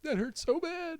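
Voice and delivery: very high mock-child whine; wailing kid. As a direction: imitate a hurt kid complaining. Voices the child's complaint.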